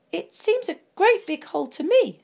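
high squeak of a nervous hobbit; squeaky. A narrator's reading. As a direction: squeak it out, small and eager. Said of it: "squeaked Bilbo".

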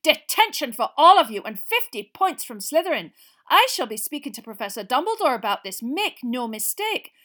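loud, high angry scolding; furious shouting. A narrator's reading. McGonagall scolding the Slytherins, furious shout. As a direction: tear into them furiously. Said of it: "she was shouting".

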